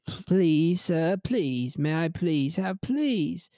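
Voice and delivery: hammed-up slow plea; mock begging. Showing why he feels weird saying please, mock begging. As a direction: beg like a pauper, stretching the last word. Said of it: "It's a begging word".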